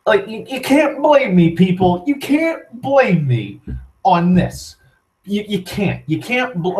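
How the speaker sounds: high, emphatic self-defence; pleading protest. Real, not acted. Storyteller begging people not to blame him, emphatic protest. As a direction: plead your case, pitch jumping way up.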